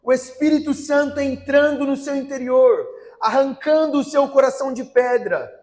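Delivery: forceful, driving sermon delivery; fervent preaching. Acted. Preacher urges churchgoers that they must be born again, fervent preaching. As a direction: press every line home to the congregation.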